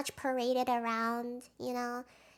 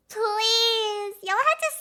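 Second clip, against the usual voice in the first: exasperated plea; 8 semitones higher, 7 dB louder, slower than usual. Real, not acted. Pleading with the audience.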